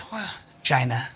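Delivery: low, soft deadpan impression; hushed, wary politician impression; Donald Trump. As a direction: drop low and quiet on the country's name. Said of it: "You do this Trump voice".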